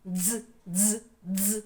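«дз» as one short buzzing consonant; окремий звук. Shown as a demonstration. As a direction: repeat «дз» alone, with no vowel after it. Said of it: "наш одинарний звук дз". (уривчасте дзижчання «дз»)

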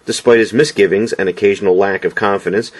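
plain reading voice

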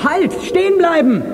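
high, urgent shout; guard's high command shout; shouting halt. Acted. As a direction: yell after the escaping car. Guard yells at escaping car.

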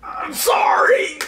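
slow, high hammed-up sob; mock crying. Hammed-up crying apology to his girlfriend. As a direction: wail the apology like a soap opera.

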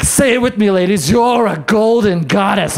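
slow, drawn-out motivational chant; rally the class like a coach; spin instructor mantra. Retold or imitated. Instructor leading the ladies, slow motivational chant.

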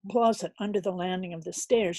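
usual speaking voice

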